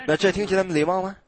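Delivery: usual speaking voice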